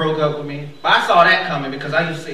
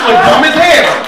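much louder, higher burst; loud exclamation; 9 semitones higher, 11 dB louder than usual. Real, not acted. Comic telling the crowd how funny quitting without a car is, loud burst. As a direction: blurt it out loud and high.

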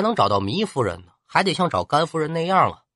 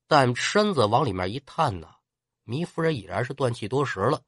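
usual speaking voice

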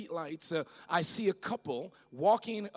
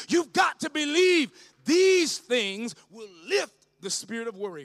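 loud, rising shouted sermon, slowing on the big lines; full-force preaching; 8 semitones higher, 13 dB louder than usual. Acted. Pastor rousing the congregation against worry, shouting and building. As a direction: lift the whole room with a shout.

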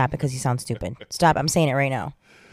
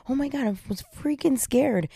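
scared, near crying; 9 semitones higher than usual. Retold or imitated. Wife recalling the worried phone call, scared near-crying voice. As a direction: replay being scared, pitch lifted high. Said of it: "I sounded like I was crying".

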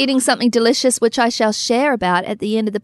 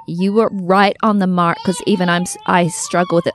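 usual speaking voice

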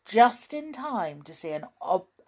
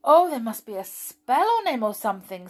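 quick, higher, anxious rush; frightened; 5 semitones higher, 8 dB louder, faster than usual. Acted. Molly frightened about the sleeping pixie. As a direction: blurt it out, worried and scared. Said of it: "rather frightened".

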